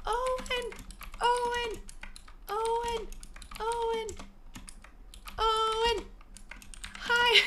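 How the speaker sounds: high sing-song call across the room. Real, not acted. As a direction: call his name out, pitched high and drawn. Streamer calling out to Owen.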